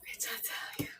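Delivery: breathy whisper under her breath; whispered aside. Real, not acted. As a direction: whisper it just to yourself. Aside after realizing viewers saw.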